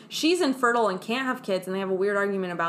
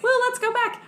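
mock crew; 13 semitones higher than usual. Crew voiced high.

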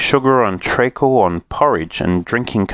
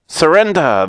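cry; 8 semitones higher, 3 dB louder than usual. Acted. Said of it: "they cried".

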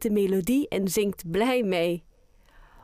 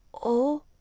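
surprised; 5 semitones higher than usual. Acted. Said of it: "zegt Oscar verbaasd".

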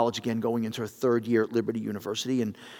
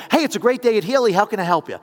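higher-pitched dealership greeting, imitated; chirpy phone receptionist; 7 semitones higher, 7 dB louder than usual. Girl answering the dealership phone, mock cheery greeting. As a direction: put on an upbeat phone-answering voice. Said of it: "they'd always answer the phone".

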